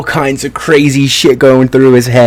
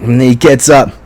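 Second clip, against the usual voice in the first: ordinary talk, nothing marked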